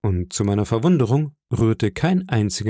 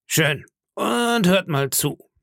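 ship's cook; 8 semitones higher, slower than usual. Acted. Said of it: "sagte der Schiffskoch".

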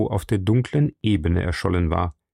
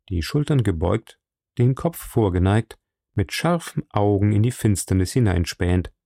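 usual speaking voice

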